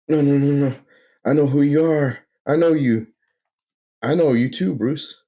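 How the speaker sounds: a man refusing what he sees; panicked denial. Acted. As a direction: Bruce waking in the asylum, panicked. Bruce wakes, denies doctor.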